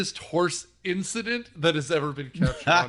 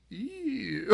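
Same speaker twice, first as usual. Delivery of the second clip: disgusted ew; 5 semitones higher, 11 dB quieter than usual. Retold or imitated. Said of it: "Naomi Watts is like".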